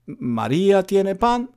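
slow "María tiene pan" rising on pan; question intonation. Shown as a demonstration. Teacher shows intonation alone makes a question, slow rise. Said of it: "la entonación de la frase".

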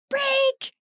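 high-pitched mock shout; imitated shout. Older sister signalling her brother on the toboggan, imitated shout. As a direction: call it out high, imitating a child's warning. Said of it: "I would shout".

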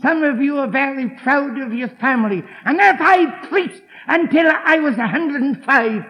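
loud, high fervent sermon; preaching at full force. Acted. Preacher exhorts the congregation, loud and fervent. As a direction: drive the message home.

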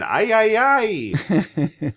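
high ay ay cries; excited cry. Retold or imitated. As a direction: yelp it out high. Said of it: "And then".

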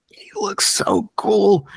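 high, drawn-out fan gush; retold awe. Fan recalling his first look at the trailer, awed. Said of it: "I was like".

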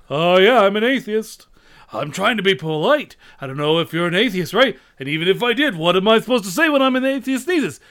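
smug loud Dangerfield rant; condescending. Acted. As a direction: talk down to him, Dangerfield style. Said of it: "very condescending".

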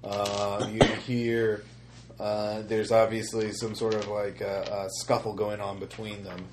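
ordinary delivery

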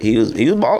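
normal talking voice

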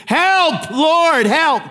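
high, loud plea for help; crying out to God. Pretend. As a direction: cry out, desperate. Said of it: "call on the name of the Lord and say".

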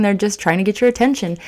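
ordinary delivery

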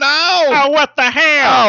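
high, loud cartoon exclaim; high cartoon outburst; high put-on voice. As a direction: shout it in a squeaky silly voice. Joking voice game.

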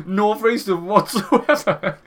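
high, slow mock refusal; imitated call handler. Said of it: "they were just like".

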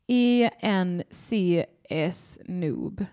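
reading the letters E, N, C, S one by one, very slowly; slow spelling out. Shown as a demonstration. Host puzzling over a chat name, reading letters very slowly. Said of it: "det bara är, eh, så här små bokstäver".

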